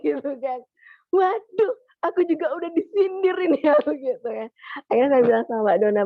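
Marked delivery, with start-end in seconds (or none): 1.1-3.7 a loud, high-pitched "waduh", then laughing; shocked exclaim. Retold or imitated. Feels teased, exclaims in shock.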